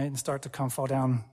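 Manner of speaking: ordinary talk, nothing marked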